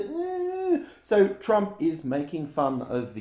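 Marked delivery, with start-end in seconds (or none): 0.0-0.8 mocking grunt. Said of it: "he's like".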